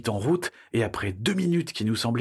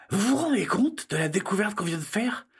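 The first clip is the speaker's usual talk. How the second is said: put-on high, amazed child's voice; excited child; 8 semitones higher than usual. Child marvelling to friends at their discovery, high and excited.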